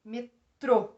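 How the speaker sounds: "Metrô" with a loud, separated TRÔ; "Metrô" as me, pause, TRÔ; stress on last syllable. Shown as a demonstration. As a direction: hit the end of "Metrô". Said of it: "É o trô".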